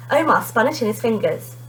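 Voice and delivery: usual speaking voice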